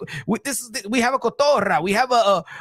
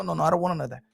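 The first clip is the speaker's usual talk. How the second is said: ordinary delivery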